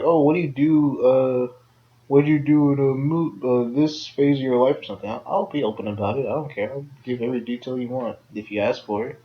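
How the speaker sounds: ordinary delivery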